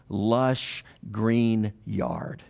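normal talking voice

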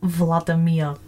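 low, clumsy stab at a Russian accent; attempted Russian accent. Shown as a demonstration. Copying co-host's example, badly faked Russian accent. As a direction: copy a Russian accent, not very well. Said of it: "you do it and I'll copy you".

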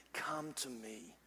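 soft, slow, beckoning voice of God; gentle call. Acted. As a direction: draw them in tenderly, barely above a breath. God calling the weary gently.

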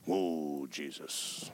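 soft, low "Whoa, Jesus"; hushed awe. Retold or imitated. Preacher reacting to the spirit, soft low awe. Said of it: "And I'm like".

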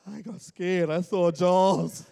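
ordinary delivery

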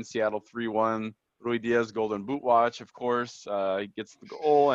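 usual speaking voice

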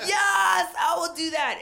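very high, loud shout of assent; ecstatic yell. Retold or imitated. Inspired comic answering her new mentor, ecstatic yell. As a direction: shriek a wholehearted yes.

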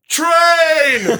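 mock yell of "Train!" from the background. Extra in the background yelling a warning, mock shout. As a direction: bark out "Train!" up high and loud.

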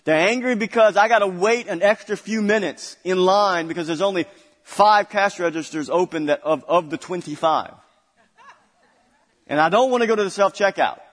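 worked-up pulpit rant; fired-up preaching. Real, not acted. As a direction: preach it loud, voicing the impatient shopper.

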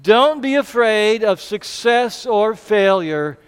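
slow, emphatic unison line; say it together. Shown as a demonstration. Preacher leading the church in the big idea, slow and emphatic. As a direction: lead the congregation in it, slowly. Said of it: "let's say it together".

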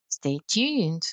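ordinary talk, nothing marked

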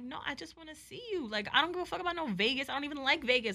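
high, earnest re-enacted protest; acting out an exasperated appeal; retold insistent plea. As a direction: insist on it, pitch up high then easing down.

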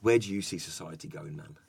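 a hint of a spaced-out late-night philosopher; stoner guy quote. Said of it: "he said to me".

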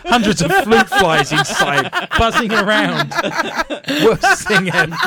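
loud, high, through laughter; cracking up. Real, not acted. Host pictures flies in the machine, cracking up. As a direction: laugh helplessly while talking.

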